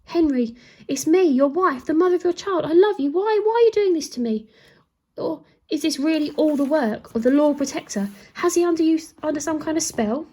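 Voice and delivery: high, loud anguished plea; desperate pleading. A narrator's reading. Queen begging the king as she is condemned, high and loud. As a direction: cry out to the husband in despair. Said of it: "screamed the queen".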